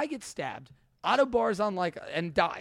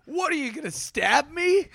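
loud, high, drawn-out jeer; slow, exaggerated mocking question; mock taunt; 10 semitones higher, 7 dB louder than usual. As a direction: mimic a drunk, incredulous comeback. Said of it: "Mike's response to the bouncer being upset".

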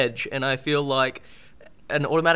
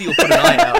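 laughing mock scolding; 7 dB louder than usual.

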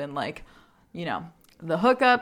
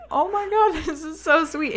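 high, delighted gush; touched squeal; 10 semitones higher, 4 dB louder than usual. Retold or imitated. Friend recalling being complimented, gushing high. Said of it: "And I'm like".

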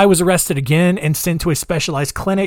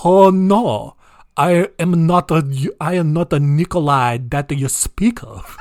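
put-on hybrid Russian-Chinese accent. Nikolai denying his identity to the colonel in a hybrid accent. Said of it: "that weird, like, hybrid accent".

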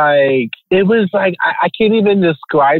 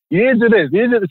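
raised pitch, imitating own directions on set; photographer directing; 7 semitones higher, much faster than usual. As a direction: act out instructing a subject mid-shoot. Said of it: "when I'm directing, I'm like".

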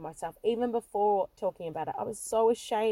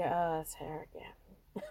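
softer, slow, bored sigh of a line; weary eye-roll; 6 semitones lower, 8 dB quieter, slower than usual. Retold or imitated. Church crowd seeing her get up again, weary and slow. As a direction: mimic a tired crowd, unimpressed. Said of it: "roll their eyes and go".

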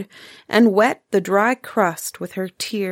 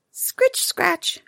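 high squeaky scritch-scratch; a small high mouse scrabble; mouse scratching noise; 10 semitones higher, 3 dB quieter than usual. A narrator's reading. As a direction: make the tiny scratching of a mouse.

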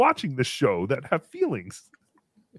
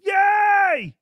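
high, drawn-out shouted yay; cheering; 13 semitones higher, 6 dB louder than usual. Pretend. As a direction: let out a long, loud cheer. Cheering super chats.

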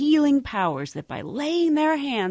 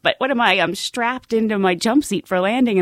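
ordinary talk

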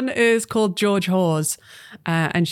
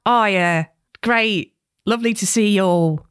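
Cockney-style South London greeting; South London accent. Acted. Book club guest arriving, in a South London accent. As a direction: chirp hello like a South Londoner. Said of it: "South London. She says".

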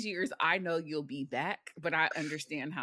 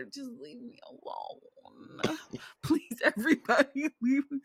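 higher, pleading to be left alone; exasperated plea; 6 semitones higher than usual. Real, not acted. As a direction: beg everyone to back off, fed up. Fed up, begging to be left alone.

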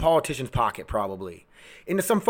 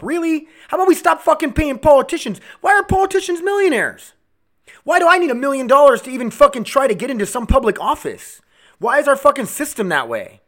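high, loud, indignant questions; angry rant; 9 semitones higher, 5 dB louder than usual. Real, not acted. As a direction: demand answers, voice raised in outrage. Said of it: "I'm just angry right now".

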